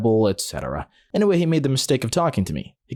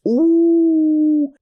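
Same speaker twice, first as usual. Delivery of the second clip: long, high, loud mocking "ooh"; crowd "ooh"; 6 dB louder than usual. Classmates reacting to a comeback, drawn-out ooh. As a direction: hold out a high schoolyard "ooh". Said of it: "everyone just went".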